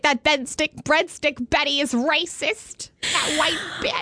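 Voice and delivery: slow snide voice; catty commenter voice. Retold or imitated. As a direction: sneer it like an online troll. Imitating offended commenters.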